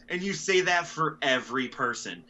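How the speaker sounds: usual speaking voice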